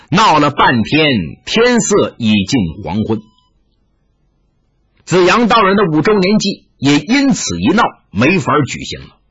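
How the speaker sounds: usual speaking voice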